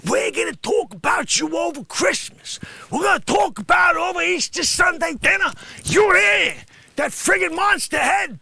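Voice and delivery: high, loud mock rant; hammed-up angry tirade at Sonny; comic angry shout. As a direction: rant at him at full volume.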